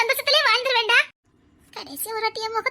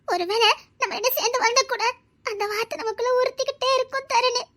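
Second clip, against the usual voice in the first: ordinary delivery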